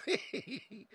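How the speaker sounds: can barely stop laughing at the chat; helpless laughing. Real, not acted. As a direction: laugh through the word See.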